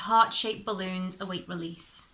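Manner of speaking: plain reading voice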